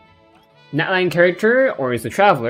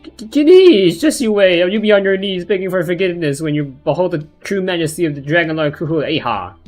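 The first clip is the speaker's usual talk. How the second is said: much higher, loud sputtering outrage; indignant shout; 6 semitones higher, 7 dB louder than usual. Acted. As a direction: yell it like an offended little dragon. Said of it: "I guess I'll voice it".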